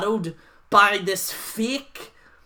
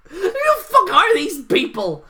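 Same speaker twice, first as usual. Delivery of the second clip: higher, louder indignant yell; exasperated outburst; 10 semitones higher, 5 dB louder than usual. Real, not acted. Ranting about fake news sources, loud and indignant. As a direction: demand it, incredulous.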